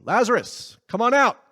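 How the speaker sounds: loud, high summons into the tomb; calling out. Retold or imitated. Jesus calling Lazarus out of the tomb, ringing loud. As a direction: call him out of the grave, rising loud. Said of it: "he calls to her brother inside the tomb".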